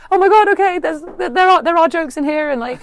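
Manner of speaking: high, loud, breathless delight; gushing with relief and joy; overjoyed, near tears. Retold or imitated. Writer hearing her pilot read aloud, overwhelmed with joy. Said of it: "I almost burst into tears 'cause I was like".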